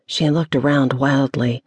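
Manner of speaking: usual speaking voice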